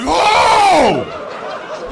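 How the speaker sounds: loud, high, drawn-out gasp of shock; mock astonishment. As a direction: cry out as if blown away, hammed-up. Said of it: "He was blown away".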